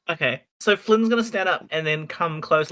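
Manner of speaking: normal talking voice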